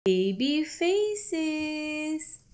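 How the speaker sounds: drawn-out, slow title; slow title read. Acted. Parent opening a face book, drawing out the title. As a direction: stretch the title for baby.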